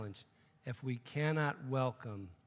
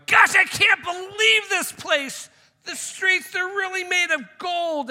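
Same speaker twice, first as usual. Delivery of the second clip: much higher, louder, slowed-down gasp of wonder; amazed shout; 14 dB louder than usual. Acted. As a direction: belt it out like a wide-eyed newcomer. Awestruck man arriving in heaven.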